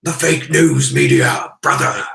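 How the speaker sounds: slow, loud wrestler shout; wrestling promo. Acted. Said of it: "before I blow my, my voice out".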